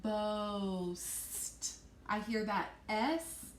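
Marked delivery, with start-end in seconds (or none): 0.0-1.7 "Boast" drawn out into a hissed S and final T; stretched out. Shown as a demonstration. Teacher showing the end blend, "Boast" stretched slowly. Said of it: "two letters at the end that I blend together".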